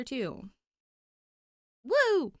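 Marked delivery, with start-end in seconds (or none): 1.8-2.3 loud, high happy whoop; excited cheer. Real, not acted. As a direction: cheer for the new chapter. Celebrating her new chapter two.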